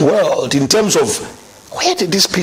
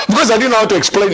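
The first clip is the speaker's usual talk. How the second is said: laughing; 5 semitones higher, much faster than usual. Real, not acted. Laughs at own awkwardness.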